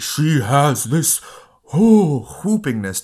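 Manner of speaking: big, drawn-out whooping voice, high and loud; imitating bellowing. Film fan imitating Piper Laurie's voice, bellowing whoop. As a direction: swell into a slow, loud whoop. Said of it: "great ability to make her voice bellowing".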